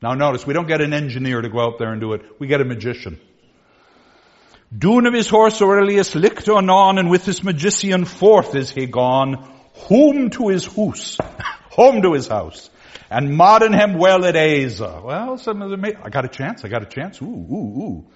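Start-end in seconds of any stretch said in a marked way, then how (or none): 4.8-15.0 put-on loud, rounded old vowels read aloud; Middle English reading. Shown as a demonstration. Teacher reading Chaucer's lines to the class in Middle English. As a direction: recite the old text like Chaucer. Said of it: "reading Middle English aloud".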